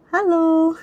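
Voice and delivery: normal talking voice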